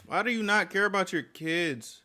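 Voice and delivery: ordinary delivery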